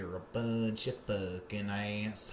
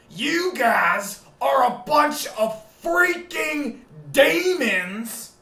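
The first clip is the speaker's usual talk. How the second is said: much higher, louder, slow raving; raving rant; 13 dB louder, much slower than usual. Acted. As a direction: rant like a raving radio host. Alex Jones style rant.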